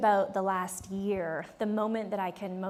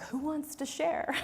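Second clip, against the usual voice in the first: mock innocent invitation; 7 semitones higher than usual. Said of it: "I pivot and I'm like".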